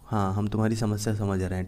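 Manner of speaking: ordinary delivery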